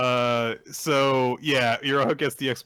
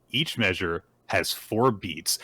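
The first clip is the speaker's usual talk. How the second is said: ordinary delivery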